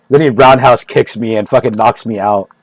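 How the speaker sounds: ordinary talk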